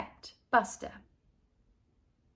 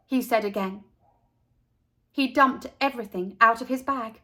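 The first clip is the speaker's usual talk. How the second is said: ordinary delivery, nothing marked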